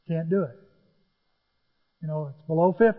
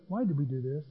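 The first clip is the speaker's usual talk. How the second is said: quiet muttering; 3 semitones lower, 6 dB quieter than usual. Retold or imitated. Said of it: "we're saying to each other".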